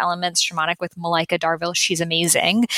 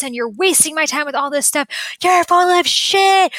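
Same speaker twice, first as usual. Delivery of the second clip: much higher and louder, raging at the teacher; retelling a woman's furious outburst at her teacher; furious screaming; 7 semitones higher, 3 dB louder than usual. Retold or imitated. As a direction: tear into her like she's a fraud, full volume. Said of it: "she starts screaming at our teacher".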